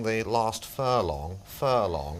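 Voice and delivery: very slow, drawn-out announcer impression; Norris McWhirter. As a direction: stretch each word like a commentator. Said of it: "sounds a bit like Norris McWhirter".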